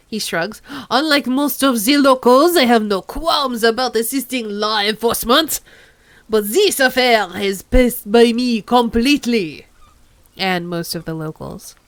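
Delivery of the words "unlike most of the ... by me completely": put-on hammy French accent, proud; over-the-top French gentleman; bad French accent. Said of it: "over-the-top bad French accent".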